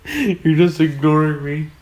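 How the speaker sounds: high, laughing protest; laughing complaint. Real, not acted. Whining to his brother while laughing. Friend keeps ignoring him.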